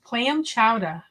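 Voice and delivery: slow, drawn-out Boston "chowdah"; Boston accent. Shown as a demonstration. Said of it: "say this with a Boston accent".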